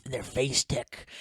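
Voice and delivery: put-on slow, confused old man voice. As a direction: mangle it like a grumpy grandpa. Old man mangling app names.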